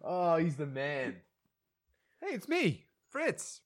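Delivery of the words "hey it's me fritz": very high, softer toddler imitation; tiny child's voice introducing himself; Fritz. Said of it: "his toddler son Fritz comes down the steps".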